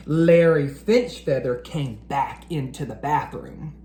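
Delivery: plain reading voice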